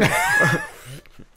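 a high, loud burst of laughter; shrieking laugh. Real, not acted. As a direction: squeal with laughter at your own joke. Explodes laughing at the punchline.

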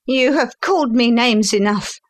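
high-pitched, offended protest; indignant son. A narrator's reading. Son tired of father's accusations, indignant. Insulted son answers back.

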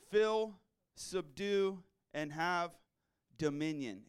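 very slow, deliberate, loud reading; slow scripture emphasis. Real, not acted. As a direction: weigh every word of the verse.

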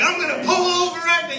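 high-pitched exasperated dad threat; mock parental threat. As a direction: play the dad losing it in the car. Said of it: "yelling. You know, I'm just like".

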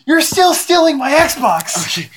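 high, drawn-out complaint; indignant protest. Real, not acted. Protest ending the squabble.

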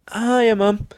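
high, drawn-out sheepish greeting; acted high, meek hello; meek little mouse. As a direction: switch to a put-on sweet little voice for mum. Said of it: "he's the quiet little mouse".

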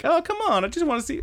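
very high put-on pleading; wheedling character voice. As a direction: coax like a nosy cartoon houseguest. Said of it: "she's like".

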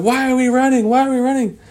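high, loud panicky repeat; panicked question. Acted. Kids running with the Lost Boys asking why, high and panicked. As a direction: ask it breathless in alarm.

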